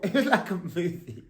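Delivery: said through a soft laugh. Real, not acted. Telling viewers about the chase, laughing. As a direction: laugh it out, amused.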